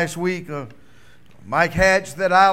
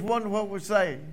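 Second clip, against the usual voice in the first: ordinary delivery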